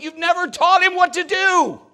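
very high, very loud outburst; angry shout. Retold or imitated. Dad confronting coach yelling at his son, angry shout. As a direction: re-enact a dad exploding at the coach.

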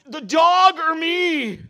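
high, very loud, slow shrieking demand; mock ultimatum from wife. Wife demanding he choose between her and the dog, hammed-up shriek. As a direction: wail it like a fed-up wife. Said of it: "And Kim is like".

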